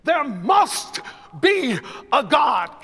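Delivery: loud, high, drawn-out preacher's cry; preacher shouting. Shown as a demonstration. Conductor repeats the preacher's cry for the choir. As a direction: hit "There" high and shout it slow. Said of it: "Let me say that again".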